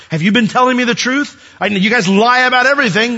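high, loud, accusing challenge. Acted. Joseph doubting the brothers who betrayed him, loud and accusing.

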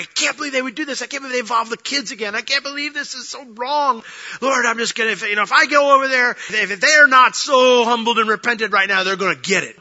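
loud, high, fast furious venting; angry rant. Retold or imitated. Pastor fuming in his car on the way over, loud and angry. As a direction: rage out loud alone in the car. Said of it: "I am mad".

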